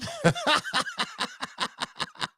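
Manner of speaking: long, high-pitched cackle; laughing. Real, not acted. Host reading a viewer's comment, high helpless laughter. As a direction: crack up in high, trailing giggles.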